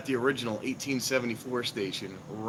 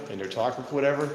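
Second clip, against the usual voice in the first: usual speaking voice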